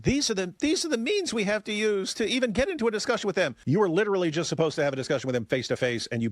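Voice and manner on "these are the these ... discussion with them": mocking quote of Korah. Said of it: "She said".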